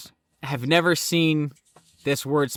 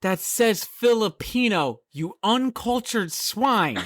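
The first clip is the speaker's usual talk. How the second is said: slow, higher mock-haughty rebuke; scornful correction; 7 semitones higher, slower than usual. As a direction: draw out every word in theatrical disdain. Acts out scolding the ignorant brother.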